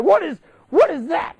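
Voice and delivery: loud, high-pitched mock panic; panicked cry. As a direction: yelp it in hammed-up alarm. Retold panic at giant cockroach.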